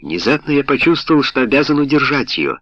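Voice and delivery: ordinary delivery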